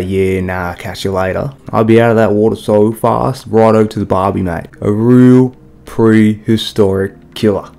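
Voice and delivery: loud, broad Australian wildlife presenter; Steve Irwin Australian accent. Pretend. Nature host shows off a croc, in a loud over-the-top Australian accent. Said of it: "let me show you what that looks like, or sounds like".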